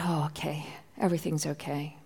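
very soft, low relieved thought; relieved murmur. Retold or imitated. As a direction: breathe it out quietly in relief. Relief seeing son safe.

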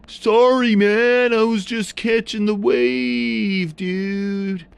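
high, loud surfer dude voice; surfer seal. A narrator's reading. Narrator voicing the surfer seal to the child, high surfer drawl. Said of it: "And the seal said".

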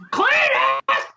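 very high mimicked wife's call; high-pitched wife voice. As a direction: cry out shrilly, imitating the wife. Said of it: "the really high-pitched voice".

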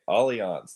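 "Allianz" as AH-lee-ahnz; correct German pronunciation. Shown as a demonstration. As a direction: open "Allianz" on a broad ah, not like aliens. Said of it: "It looks like aliens".

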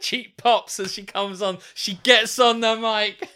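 high, loud, drawn-out announcing; mock ringside commentator. [name] commentates mum's entrance, slow, loud mock announcer. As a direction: build the hype like wrestling commentary.

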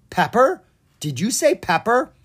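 eager high rise on pepper; perked up. Acted. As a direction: pounce on the word like a clue. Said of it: "Ella perked up".

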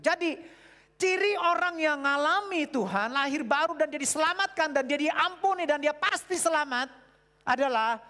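fervent loud sermon reading; preaching at full force. Acted. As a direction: proclaim the verse to the crowd.

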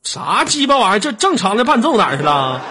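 annoyed outburst. Real, not acted. Wrong music track plays.

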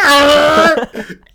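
loud high blast of noise; mock wail. Drowning out the caller on the phone, high mock wail. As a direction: yell wordlessly over the caller.

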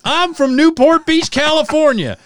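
high, loud Southern twang; Southern drawl. Retold or imitated. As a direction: show the childhood Southern accent, high and loud. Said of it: "Why do you talk like this?".

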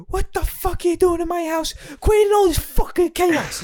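imitating a furious husband; mock furious dad. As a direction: rant like an angry blue-collar father. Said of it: "that he's like".

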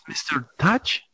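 usual speaking voice

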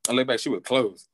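usual speaking voice

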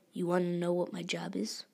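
ordinary delivery

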